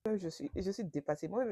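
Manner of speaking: usual speaking voice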